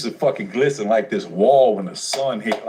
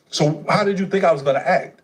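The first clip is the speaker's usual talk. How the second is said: higher, fast, accusing questions; heated confrontation; 7 semitones higher, faster than usual. Retold or imitated. Son confronting his mother about her choices, heated and fast. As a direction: fire the questions at her, rising anger.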